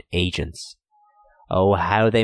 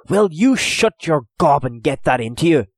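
loud, rough telling-off; gruff scolding; 7 semitones higher, 5 dB louder than usual. A narrator's reading. Owen scolding Hugh to drink and shut up. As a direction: bark at him to calm down.

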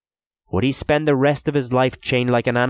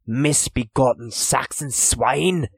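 slow, higher cursing; angry curse; 5 semitones higher, slower than usual. A narrator's reading. Owen curses the captor from his chains, slow angry curse. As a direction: spit out a slow insult.

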